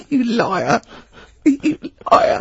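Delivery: high, helpless laughter through the words; laughing accusation. Real, not acted. Co-host disbelieves friend's culture claim, laughing accusation. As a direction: squeal it out while cracking up.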